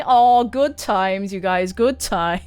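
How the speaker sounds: slow wistful sigh of good times. Acted. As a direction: drag it out, lost in fond memories. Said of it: "He looks so, like, wistful".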